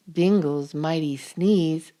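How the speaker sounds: plain reading voice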